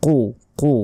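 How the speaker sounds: কো with a thick back q, rounded o; heavy letter. Shown as a demonstration. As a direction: say কো full-mouthed from the throat. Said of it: "এখানে কফ আছে".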